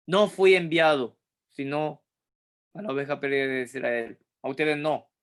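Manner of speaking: Jesus brushing the woman off, unfriendly and cold; cold and curt. Shown as a demonstration. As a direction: snap it off coldly, no warmth at all. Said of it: "Penca, antipático, terrible, frío, cortante".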